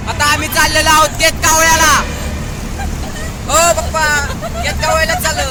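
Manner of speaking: loud, high calls to hear the echo; shouting in the tunnel. Real, not acted. Vlogger shouting to viewers inside a tunnel, loud and high. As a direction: yell it out so it booms off the tunnel walls.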